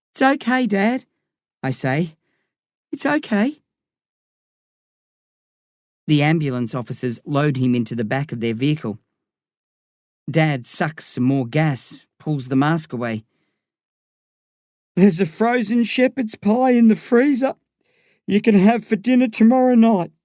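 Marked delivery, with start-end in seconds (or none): 0.1-1.1 put-on high-pitched boy's reassurance; comforting child. A narrator's reading. Child holding back tears comforts Dad. 2.9-3.6 very high, gentle reassurance; comforting child. A narrator's reading. Child comforts Dad once more. 15.0-20.1 high, strained call in a put-on dad voice; dad on a gurney. A narrator's reading. Dad on gurney instructs his boys.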